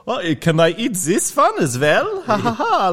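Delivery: high, cackling mock witch; a theatrical witch cackle over the bowl; hammed-up witch gag. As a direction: play the greedy witch for laughs. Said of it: "I do this gag sometimes with people where I hold the bowl and go".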